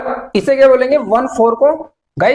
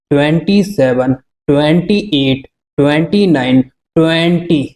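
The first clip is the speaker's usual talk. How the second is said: lower, measured classroom counting; reciting numbers; 8 semitones lower, slower than usual. Acted. Teacher counts the series aloud for the class, in a lower, measured voice. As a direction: count them out one by one for students.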